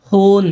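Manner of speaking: হুন with a held oo vowel; long oo. Shown as a demonstration. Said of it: "দীর্ঘ এর মতো উচ্চারণ হবে".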